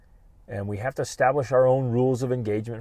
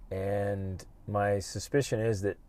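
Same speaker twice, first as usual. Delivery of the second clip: usual speaking voice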